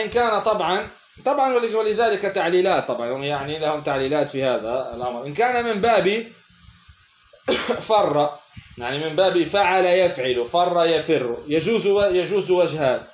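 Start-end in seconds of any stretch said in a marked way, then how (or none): none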